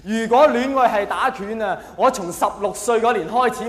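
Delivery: usual speaking voice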